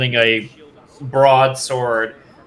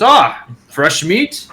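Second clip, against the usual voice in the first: slow, high, loud half-orc greeting; gruff half orc; 5 semitones higher, 5 dB louder, much slower than usual. Acted. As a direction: drawl it out like a scarred half orc sizing up a newcomer. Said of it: "he goes".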